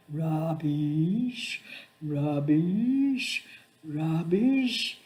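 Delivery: put-on a child parroting an adult's word; lightly voiced child mimicking her carer; child copying my voice. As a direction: echo the word back like a little parrot. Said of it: "in my voice, using my voice".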